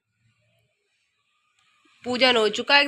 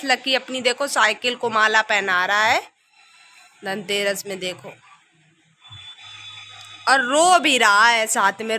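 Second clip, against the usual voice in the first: usual speaking voice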